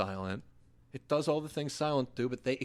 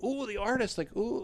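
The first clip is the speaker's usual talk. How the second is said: mock oohing, pitched high; higher-pitched imitation of excited fans; imitating impressed audiences; 9 semitones higher than usual. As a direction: coo it like an impressed crowd. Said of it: "people were like".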